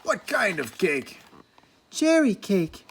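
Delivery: slow, sulky grumble; Angry asking about cake, sulky and slow; grumpy dwarf. A narrator's reading.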